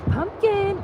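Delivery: high sing-song call to a pony; calling the horse. Real, not acted. Owner calling her horse over from the paddock, high sing-song.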